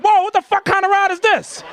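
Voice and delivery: high-pitched, startled outburst; alarmed protest. Retold or imitated. Man riding a bucking dolphin, alarmed protest. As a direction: blurt it out like a shocked rider.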